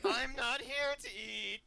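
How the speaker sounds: high, slow put-on voice for Betty. Betty tells the employee she won't eat, high and slow. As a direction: draw out Betty's line in a high voice. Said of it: "That's gonna be your voice from now on".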